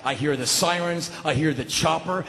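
ordinary delivery

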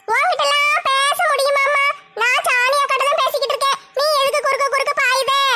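high-pitched, protesting put-on voice. Arguing that prayer must be noisy, high put-on voice. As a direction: argue back in a high character voice.

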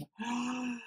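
soft, high gasp; real gasp of pleasure; delighted gasp. Real, not acted. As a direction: breathe in sharply, thrilled. Delighted by scent.

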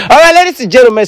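high, laughing announcer call; laughing host intro. Real, not acted.

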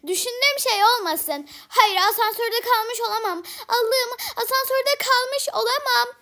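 rising panic, pitch climbing high; scared panic. Pretend. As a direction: panic about being stuck in a lift. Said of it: "Şu an çok korkuyorum". (korku içinde panik)

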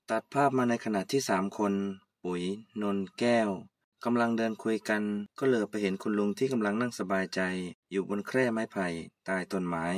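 low, soft narrator voice setting the scene; story narration. A narrator's reading.

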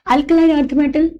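ordinary delivery

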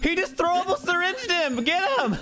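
high urgent yell to chase; rally everyone, hurry; excited shout. Real, not acted. Alarm, urging others to chase.

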